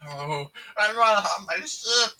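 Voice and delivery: high, loud whiny alien voice; Stitch voice. Retold or imitated. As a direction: whine it like a little alien.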